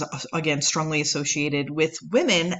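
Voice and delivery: ordinary delivery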